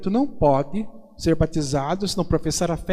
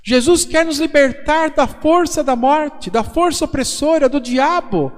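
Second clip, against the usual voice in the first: fervent preaching; 8 semitones higher, 3 dB louder than usual. Acted. Priest proclaiming freedom from the devil's power, impassioned preaching.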